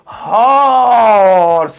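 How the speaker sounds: slow, drawn-out horse with each sound stretched; drawn-out demo pronunciation; slow motion. Shown as a demonstration. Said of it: "slow motion में horse".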